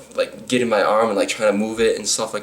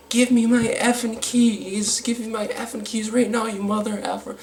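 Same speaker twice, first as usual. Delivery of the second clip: high, drawn-out mock whine; hammed-up, high-pitched demand, stretched out; mock demanding drunk woman; 14 semitones higher than usual. Drunk woman demanding her keys from the man who took them, mocking high voice.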